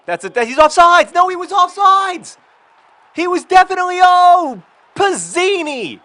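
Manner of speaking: high, loud outraged shout at the ref; furious yelling at the game. Real, not acted. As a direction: scream at the screen as the call goes wrong.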